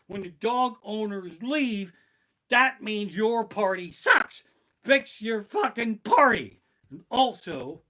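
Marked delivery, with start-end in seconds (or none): 0.1-6.6 loud, high and slow, word by word; slow furious rant. Acted. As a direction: hammer each word at the host. Ranting at the party host.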